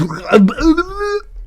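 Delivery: high-pitched giggle at own joke; high laugh. Real, not acted. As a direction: break into a squeaky laugh.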